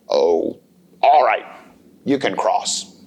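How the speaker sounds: low, grudging troll voice. Acted. Troll letting the little goat cross, low and grudging. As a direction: give in with a low sigh.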